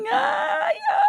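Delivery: high, shouted little yelps; retold startled ai ai; mock yelp. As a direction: cry out ai like being scolded. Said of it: "eu comecei a fazer assim".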